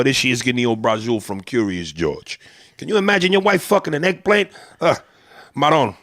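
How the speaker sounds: gossiping Italian-American storyteller; incredulous Italian-American neighborhood gossip; old-school Italian-American. Acted. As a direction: tell the guys scandal in Italian-American street talk. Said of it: "and he tells this story".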